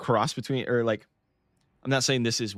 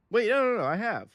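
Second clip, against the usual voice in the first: wait no no no, much higher and louder; surprised self-correction; sudden realisation; 12 semitones higher, 4 dB louder than usual. Real, not acted. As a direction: catch yourself, startled.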